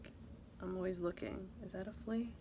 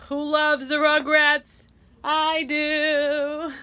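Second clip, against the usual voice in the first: loud cheer; 7 semitones higher, 11 dB louder, much slower than usual. Acted.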